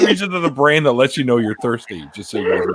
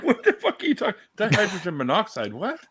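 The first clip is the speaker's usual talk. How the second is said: high-pitched, laughing mock disbelief; retold incredulity; 9 semitones higher than usual. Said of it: "I'm like".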